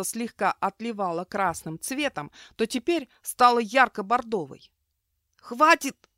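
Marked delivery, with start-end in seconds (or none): 5.4-6.1 high strangled squeak, louder. A narrator's reading. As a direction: squeeze it out high and choked. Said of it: "сдавленно пискнул Гор".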